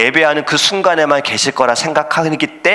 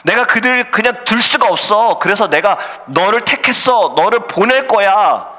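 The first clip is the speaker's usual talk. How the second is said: voice of God; 9 semitones higher than usual. Acted. God calling Jonah to go to Nineveh, commanding voice. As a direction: call the prophet with weight and urgency. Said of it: "하나님이 갑자기 요나에게 음성으로 말씀하신".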